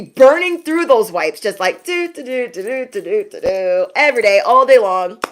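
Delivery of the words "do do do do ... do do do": wiping sound effect. Retold or imitated. Mimicking wiping motion sound.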